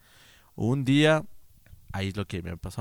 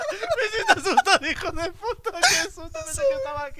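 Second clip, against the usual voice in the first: very high, laughing-crying through fright; nervous laughter; 10 dB louder than usual. Real, not acted. Narrator reliving the scare, laughing and crying. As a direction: laugh and half-sob from sheer fright.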